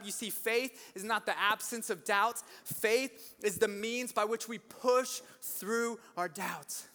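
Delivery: usual speaking voice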